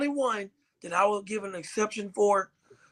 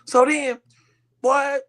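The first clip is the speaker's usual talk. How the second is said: normal talking voice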